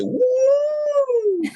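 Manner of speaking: very high, loud "woo"; long whoop thrown across the room; imitated soaring voice. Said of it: "Your voice was like".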